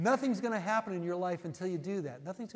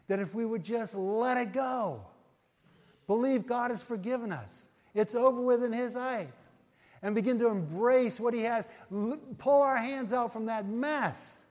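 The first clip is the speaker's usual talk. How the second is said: louder and higher, pressing the point home; preaching at full force; 4 semitones higher, 6 dB louder than usual. Real, not acted. Preacher urging his flock, loud and fervent. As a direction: preach it hard, pleading with the room.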